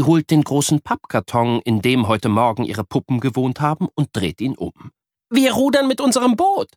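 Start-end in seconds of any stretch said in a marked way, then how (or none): 5.3-6.7 put-on high eager girl; high excited girl voice; thrilled girl. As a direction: ask with thrilled suspense. Miri proposes boat.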